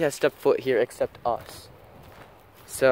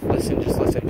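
urgent hushed whisper. Real, not acted. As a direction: whisper it so the noises stay audible. Hushing friend to hear noises.

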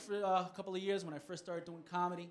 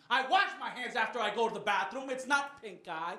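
loud, raised-pitch indignation; acted exasperated husband, mid-quarrel; indignant protest; 5 semitones higher, 6 dB louder than usual. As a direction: argue back loudly, insisting on your innocence. Retold escalating pink eye quarrel.

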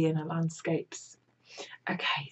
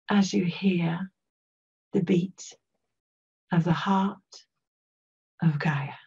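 guided meditation. Real, not acted. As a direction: guide listeners gently into an imagined place.

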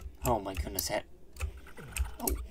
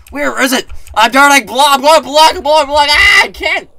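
high, very loud drowning panic; panicked shouting; 13 semitones higher, 15 dB louder than usual. Real, not acted. Gamer drowning while hunting for the exit, panicked shouting. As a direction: yell frantically, words tumbling into repeats.

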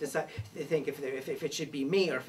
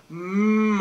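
mock moo; 4 semitones higher, 10 dB louder than usual. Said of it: "I go".

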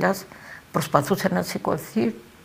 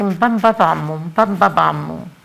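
put-on a child's tearful wail, slow and loud; crying out for daddy; 6 dB louder, slower than usual. Toddler crying to his wounded father, loud slow tearful wail. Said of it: "Έκλαιγε και φωνάζει".

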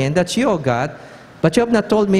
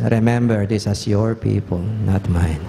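lower, drawn-down reminder; 9 semitones lower than usual. Acted. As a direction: speak it low and deliberate. Moses retold reminding God again.

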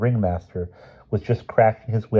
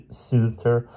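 usual speaking voice

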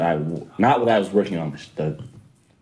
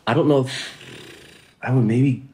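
usual speaking voice

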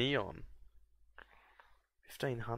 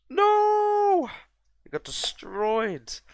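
high, loud wail of dismay; anguished shout; 11 dB louder, much slower than usual. Real, not acted. Watching his unit die, a high, loud cry of dismay.

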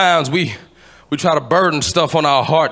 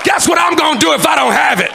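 full-force preaching; 12 semitones higher than usual. Real, not acted. Preacher urging the congregation to praise, full-force shouted preaching. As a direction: shout it out to fire up the church.

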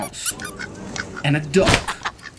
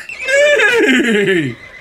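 high, louder whinnying laugh; horse neigh laugh; 11 semitones higher, 4 dB louder than usual. A narrator's reading. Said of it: "said Horse".

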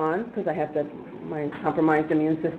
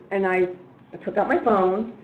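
normal talking voice

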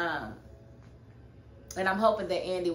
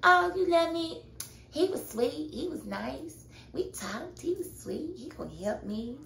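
mocking Karen; 8 semitones higher than usual. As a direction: coo like she's lovestruck. Imitating Karen's dreamy talk.

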